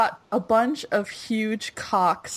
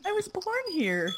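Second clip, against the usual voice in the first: put-on childish protest; 12 semitones higher, 6 dB quieter than usual. Continuing the child's reply.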